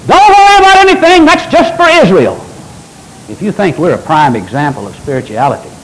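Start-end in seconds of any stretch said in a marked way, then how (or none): 0.1-2.4 high, loud mock preacher voice. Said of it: "preachers that says".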